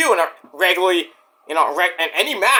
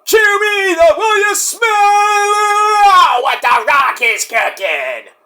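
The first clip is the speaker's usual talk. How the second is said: loud, higher hammed-up catchphrase; a loud, high mock Rock; Rock impression; 12 semitones higher, 4 dB louder, slower than usual. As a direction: ham up the wrestler's catchphrase.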